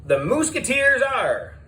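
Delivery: high, booming host announcing the contestants; game show host. A narrator's reading. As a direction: call them down like a TV quiz host.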